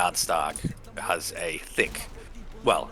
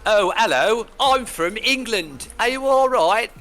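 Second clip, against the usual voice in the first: hammed-up posh English accent, much higher and louder, slow at first; mock posh Queen's English; 7 dB louder than usual. Mocking how Americans do a British accent, in hammed-up posh English. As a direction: parody the Downton Abbey English accent Americans put on. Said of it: "Queen's English".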